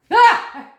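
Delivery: short, high, loud yelp; real startled "Ah!"; startled scream. Real, not acted. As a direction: cry out in sudden fright. Startled by noise while telling.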